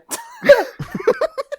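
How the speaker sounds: rising high giggle; high laugh. Real, not acted. Barkeep player laughing after offering lemonade, high giggle. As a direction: laugh at your own joke. Laughs at own juice joke.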